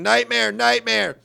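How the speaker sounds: high, loud mock scream, drawn out; retold scream. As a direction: cry it out slowly like waking in panic. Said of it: "jumped up and started screaming".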